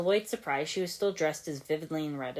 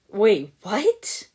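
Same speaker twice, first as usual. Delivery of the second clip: loud high startled disbelief; incredulous; 5 semitones higher, 10 dB louder than usual. Acted. Lloyd reacting to Nia, loud and incredulous. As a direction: blurt it out, stunned. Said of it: "Lloyd asked incredulously".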